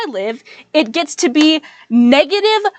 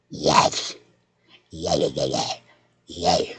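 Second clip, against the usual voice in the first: very low guttural zombie growl; zombie groan; 8 dB quieter, much slower than usual. Shown as a demonstration. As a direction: groan it like an undead monster. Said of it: "voice the zombies on The Last of Us".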